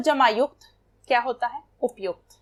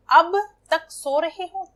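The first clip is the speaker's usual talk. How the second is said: slow, higher and louder, तक leaned on; stress on तक; 5 semitones higher, 4 dB louder, slower than usual. Shown as a demonstration. Teacher's example to students, slow scolding stress on तक. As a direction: scold gently, pressing on तक. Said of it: "तक निपात है इसमें".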